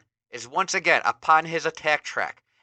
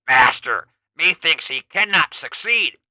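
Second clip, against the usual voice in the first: louder, beastly underling; Beastman voice; 3 semitones higher, 8 dB louder than usual. Acted. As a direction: offer doubt to the master. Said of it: "Beastman offers up".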